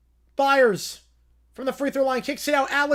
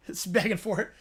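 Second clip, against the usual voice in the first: ordinary talk, nothing marked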